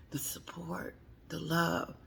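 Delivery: soft conspiratorial whisper. Real, not acted. Telling viewers the gossip, whispering. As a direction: lean in and whisper it.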